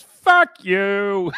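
high, loud parting shot; mock MacGruber yell. Imitating MacGruber running from the crash, yelling. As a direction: hurl it back over the shoulder. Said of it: "and he just goes".

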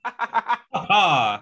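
high, loud put-on laugh; Mike Joy. Co-host shows how Mike Joy laughs, loud mock laugh. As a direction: do a big fake commentator laugh. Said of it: "That's how Mike Joy laughs".

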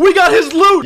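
high, loud burst of glee; gleeful yell. Real, not acted. Gamer grabbing a villager's loot, gleeful yell. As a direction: squeal it out, delighted with the haul.

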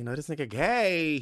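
high, loud exclamation; emphatic retold realization. Second, emphatic take of retold thought.

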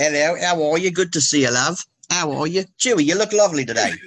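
a hint of a friendly British accent. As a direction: greet a friend like a cheerful Brit. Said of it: "That's really good".

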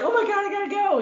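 high-pitched mock urgency; fake emergency excuse. Acting out a fake emergency phone call, mock urgent. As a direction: pitch it up like a put-on panic. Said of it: "better than the emergency phone call".

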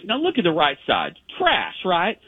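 gently mock the shabby side in her voice; imitating Sally. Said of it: "And she goes".

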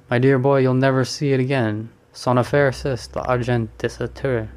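plain reading voice

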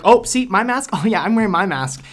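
high, loud show-off voice; smug mock boast. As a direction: preen about the mask. Mocking virtue signalling.

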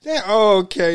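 laughing through the words; chuckling it out. Real, not acted. Laughing at casting pick.

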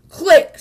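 sharp loud click; the camera snapping a picture. A narrator's reading. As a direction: snap it like a shutter. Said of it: "said the camera".

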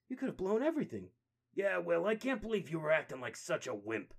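high, loud, fast bickering; heated argument. Acted. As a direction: snap back at your brother. Turtles quarrelling.

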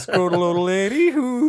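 high, slow goofy voice; silly high voice. Pretend. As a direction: ham up a cartoon name. Silly Pokemon name bit.

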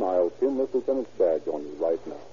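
ordinary talk

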